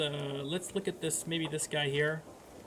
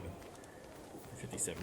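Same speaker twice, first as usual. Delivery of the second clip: whispered; 3 semitones lower, 11 dB quieter, slower than usual. Real, not acted. Shocked at candidate's age.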